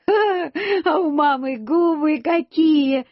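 put-on high, drawn-out giggly child's voice; laughing child. A narrator's reading. Boy laughing at his mother's blackened lips, drawn-out high laughing voice. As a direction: tease slowly through a high-pitched laugh. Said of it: "Я засмеялся".

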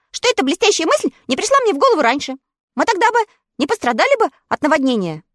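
high, quick small dog; little dog voice. A narrator's reading. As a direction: chatter like an eager little dog. Totoshka speaks.

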